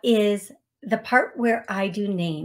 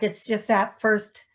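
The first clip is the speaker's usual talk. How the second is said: ordinary talk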